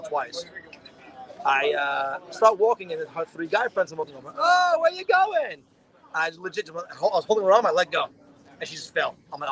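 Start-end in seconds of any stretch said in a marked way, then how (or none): 4.4-5.6 drawn-out, high, loud call; mock calling out. Her friends challenge him as he walks over, mock calling out.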